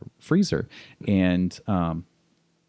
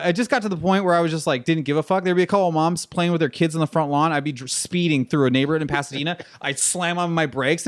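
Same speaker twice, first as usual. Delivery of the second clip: ordinary talk, nothing marked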